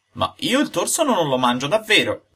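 snapping back like a viper; a stubborn puppet lashing out; angry puppet retort; shouting. A narrator's reading. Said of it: "gridò il burattino rivoltandosi come una vipera".

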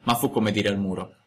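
plain reading voice

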